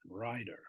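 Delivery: RIDER for writer, with a soft American D; unstressed T. Shown as a demonstration. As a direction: let the T slacken into a D in "rider". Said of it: "They don't stress their T".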